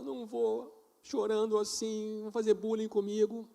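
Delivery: lightly voiced crying child; tearful little girl. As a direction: voice the upset daughter refusing.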